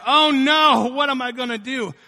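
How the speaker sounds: loud, high wail of fake dismay; mock panic. Preacher voicing the despair the devil wants, loud mock panic. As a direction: cry out in hammed-up helplessness.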